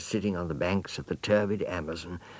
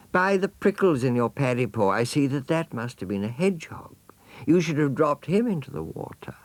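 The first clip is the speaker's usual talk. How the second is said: higher, lilting mother voice; gracious mother jaguar; 8 semitones higher, 5 dB louder than usual. Acted. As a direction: lecture him kindly like a doting mother. Mother advises son.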